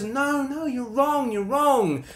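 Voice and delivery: very high mimicked pleading; wide-eyed denial. Husband mimicking his wife's denial, wide-eyed protest. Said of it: "it's all wide eyes".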